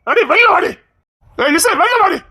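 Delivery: shouting for her to come outside; calling out angrily. Acted. Husband yelling for his wife to come out.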